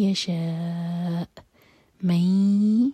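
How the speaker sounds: من held two seconds through the nose; nasal nun. Shown as a demonstration. Teacher demonstrates nasal ghunna slowly. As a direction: hum the نون of من for two seconds. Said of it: "ناک میں آواز".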